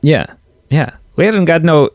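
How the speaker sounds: a really bad Irish brogue, abandoned; bad Irish accent. Podcaster tries an Irish barkeep for a co-host, bad accent. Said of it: "I was gonna do a really bad".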